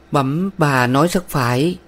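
pensive. A narrator's reading. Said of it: "Trầm ngâm".

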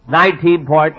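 ordinary talk, nothing marked

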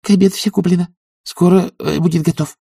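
high-pitched protesting servant woman; maid's voice. A narrator's reading. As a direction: answer the master in a high voice. Maid Anisya answers master.

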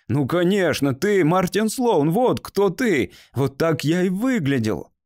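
suddenly loud. A narrator's reading. Man recognizing his younger self in a boy, suddenly loud. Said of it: "его голос внезапно стал громким".

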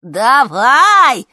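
high, very loud drawn-out yell; rowdy old ladies' shout; crowd yelling. A narrator's reading. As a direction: holler it from the audience. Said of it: "заорали старушки из зала".

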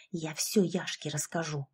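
low, hushed menace; hissed threat. A narrator's reading. Narrator threatens Alexandra for giggling, hissing low and soft. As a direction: drop down and hiss the threat. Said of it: "прошипела".

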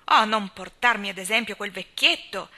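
loud scornful exclamation; exclaiming. A narrator's reading. Miss Cornelia dismissing Captain Jim, loud exclamation. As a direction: snap it out scornfully. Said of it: "esclamò la signorina Cornelia".

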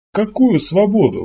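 slow, high puzzled question; baffled by the very idea; bewilderment. A narrator's reading. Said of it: "в голосе Кабанова прорезалось недоумение".

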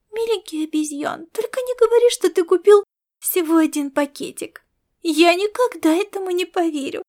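high, coaxing, cutesy lilt; wheedling sing-song. A narrator's reading. Aelita begging Laszlo for another packet, wheedling sing-song. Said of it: "вкрадчиво пропела она".

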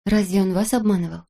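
ordinary delivery, nothing marked

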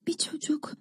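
hushed, voiceless disbelief; barely voiced, awed murmur; stunned whisper. A narrator's reading. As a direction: whisper it to yourself in amazement. Stunned girl repeats the secret.

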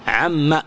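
"عما" low, with a short final a; alif dropped. Shown as a demonstration. Teacher repeating the right form, short a. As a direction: cut "عما" short on the doubled m and a. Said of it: "تحذف الألف".

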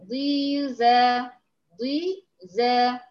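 ضيزة drawn out slowly with a heavy emphatic ض; slow demo word. Shown as a demonstration. Teacher models ضيزة slowly for students. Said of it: "عندي كلمة مثلًا زي كلمة".

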